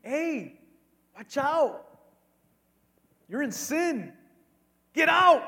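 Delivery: sharp rising call, ending in a shout; stern warning. Pretend. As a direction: warn them off, then yell. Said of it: "Admonishing means".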